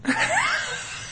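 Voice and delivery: high squealing laugh. Real, not acted. After housewife voice.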